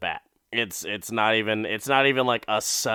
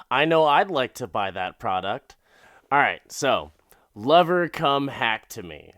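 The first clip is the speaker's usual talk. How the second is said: ordinary talk, nothing marked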